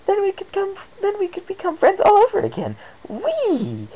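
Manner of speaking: very high, loud delighted squeal; bright and giddy. Acted. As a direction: squeal with joy. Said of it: "then smiled even brighter".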